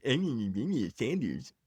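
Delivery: dismissive bleating meh; mocking meh. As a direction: imitate a bored shrug. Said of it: "people don't care because it's".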